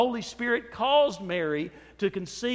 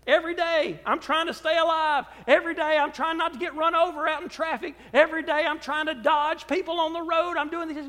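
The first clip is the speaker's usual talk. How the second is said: higher, hurried voice of a worried everyman; anxious everyday voice; 7 semitones higher than usual. Retold or imitated. Preacher voicing a worried everyman to the church, higher and frantic. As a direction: voice the frantic daily struggle to survive. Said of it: "we live lives that say".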